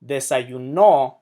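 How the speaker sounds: "Desayuno" as de-sa-yu-NO; "Desayuno" with a stressed ending; stress on last syllable. Shown as a demonstration. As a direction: hit the final no of "Desayuno". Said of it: "puts the emphasis on the last syllable".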